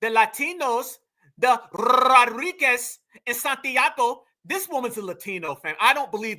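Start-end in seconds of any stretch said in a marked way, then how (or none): 0.0-4.2 mock a heavy Spanish accent; Rosie Perez impression. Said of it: "She sounds like Rosie Perez".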